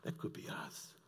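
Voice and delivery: hushed, breathless whisper; whispered realization. Acted. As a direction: breathe it out in alarm.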